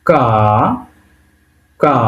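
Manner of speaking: normal talking voice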